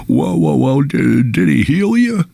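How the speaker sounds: an excited Elmer Fudd-ish priest; Jimmy Stewart Elmer Fudd. Retold or imitated. Old Jesuit priest questioning the speaker, high and eager. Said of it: "cross between Jimmy Stewart and Elmer Fudd".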